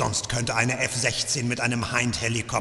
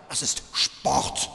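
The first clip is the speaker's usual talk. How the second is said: menacing whisper; slower than usual. Acted. Torturer explaining his cruelty.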